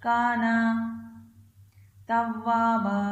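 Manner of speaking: slow, drawn-out tajweed chant, phrase by phrase; Quran recitation. Acted. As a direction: chant each phrase slowly for the child to repeat. Teacher recites Surah Nasr again.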